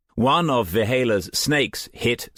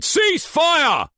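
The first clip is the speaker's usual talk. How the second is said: even higher, louder shout; 4 dB louder than usual. A narrator's reading. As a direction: scream the order over gunfire. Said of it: "Haken shouted".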